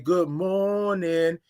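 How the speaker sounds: very slow, drawn-out "Good morning" with the r left off, "mornin'"; signature good morning. Shown as a demonstration. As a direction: stretch out "Good morning" long and lazy, no r sound. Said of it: "that's how I'm saying it".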